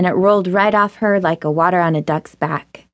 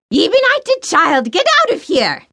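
shrewish scolding; 10 semitones higher than usual. Acted. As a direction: shoo the girl off like a shrew. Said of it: "an outraged voice squawked".

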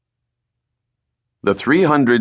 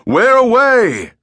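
high, loud, drawn-out hail; captain shouting up the mainmast; captain's shout; 5 dB louder, slower than usual. Acted. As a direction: bellow up to the lookout aloft. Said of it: "the captain shouted".

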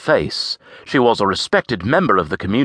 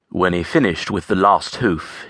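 usual speaking voice

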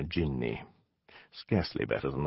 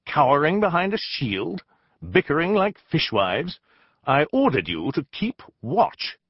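raised, higher angry rebuke; angry scolding; 8 semitones higher, 5 dB louder than usual. Acted. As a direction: tear into the lazy sentries. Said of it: "I snapped".

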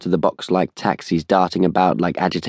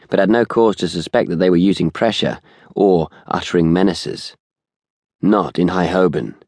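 ordinary delivery, nothing marked

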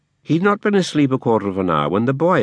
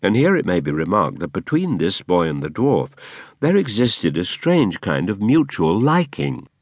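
ordinary delivery, nothing marked

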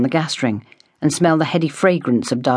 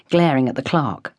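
ordinary delivery, nothing marked

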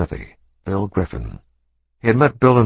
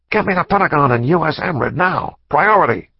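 urgent demand; 8 semitones higher than usual. Acted. Shaken pathologist ordering the Pentagon on the phone: urgent demand.

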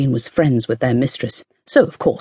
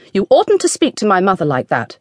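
put-on higher, firm young voice standing up to her father; spirited princess; 5 semitones higher than usual. A narrator's reading. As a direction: defend your mother boldly. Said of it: "she spoke out".

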